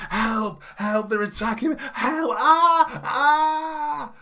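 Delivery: very high, loud cries for help; proper screaming. Acted. Woman rousing the street against strangers, screaming. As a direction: build to long screams. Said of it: "starts proper just screaming".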